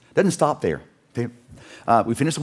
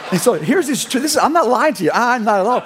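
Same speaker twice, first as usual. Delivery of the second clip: higher and louder, pressing the point; excited insistence; 9 semitones higher, 5 dB louder than usual. Real, not acted. Preacher assuring the congregation the story is true, excited. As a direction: swear it's true, all fired up.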